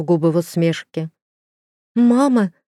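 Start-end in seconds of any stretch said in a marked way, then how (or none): 1.9-2.5 higher, louder scared call; a child calling out in fright; frightened child cry. A narrator's reading. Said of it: "Ирвин испуганно отступил".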